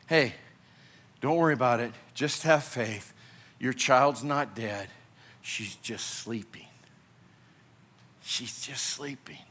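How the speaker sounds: hushed voice of Jesus, fading to a whisper; tender reassurance. Acted. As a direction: lean in and gently reassure a grieving parent.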